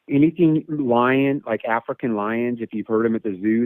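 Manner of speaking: ordinary talk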